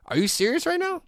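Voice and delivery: very high disbelieving question; can't believe she just asked that; incredulous. Retold or imitated. Husband answering his wife's rude question, very high incredulous disbelief.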